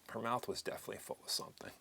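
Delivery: normal talking voice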